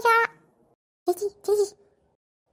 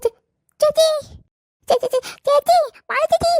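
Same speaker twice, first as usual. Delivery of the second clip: stammered call; 6 semitones higher, 5 dB louder than usual. Acted. Girl calling her sister urgently.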